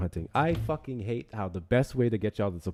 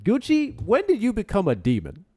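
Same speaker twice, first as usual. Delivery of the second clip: mock shock; 10 semitones higher, 7 dB louder than usual. Mock-shocked high teasing.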